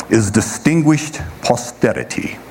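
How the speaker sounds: mock posh English accent on distinguished posterity. Shown as a demonstration. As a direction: put on a playful English accent. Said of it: "that's my English accent".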